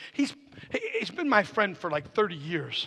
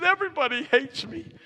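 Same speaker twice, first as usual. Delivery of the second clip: higher-pitched whiny plea; mock whining; 10 semitones higher, 5 dB louder than usual. Friend coming into the pastor's office for help, imitated whining. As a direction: imitate a man feeling sorry for himself. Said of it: "he's kinda whining".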